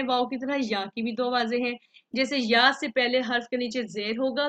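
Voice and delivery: normal talking voice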